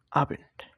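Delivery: "Abend" opening on a clear "aa"; German A as "aa". Shown as a demonstration. Teacher showing learners the German letter A, "Abend" begun with "aa". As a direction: say "Abend" with its A like Bengali আ. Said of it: "আর মতন উচ্চারণ করে".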